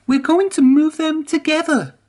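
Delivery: very high, proud declaration; proud Emily. A narrator's reading. Emily stands up for Whiff, very high and proud. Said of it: "chuffed Emily proudly".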